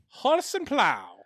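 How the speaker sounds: slow British accent, pitched high; high, drawn-out British attempt. Shown as a demonstration. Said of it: "in your British accent".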